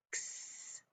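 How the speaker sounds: X as a very soft voiceless "ks"; isolated letter sound. Shown as a demonstration. Teacher drilling sound cards, X as whispered ks. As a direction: hiss the end of fox, X as "ks". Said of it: "do our letter sound cards now".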